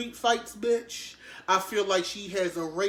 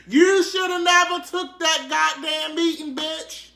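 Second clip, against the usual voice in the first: high, loud mock lament; hammed-up outraged shout; mock wailing yell; 8 semitones higher, 5 dB louder than usual. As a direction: wail it like a scandalized fan. Mock-scolding R. Kelly over meeting.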